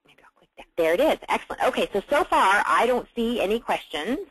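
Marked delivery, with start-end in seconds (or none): 0.1-0.7 whispered aside to self; barely audible muttered whisper. Real, not acted. Muttering to herself at screen.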